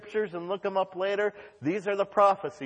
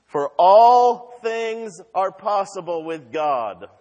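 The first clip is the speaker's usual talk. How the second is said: slow, louder proclamation; forceful preaching; 3 semitones higher, 9 dB louder, slower than usual. Real, not acted. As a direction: declare it slowly, with full weight.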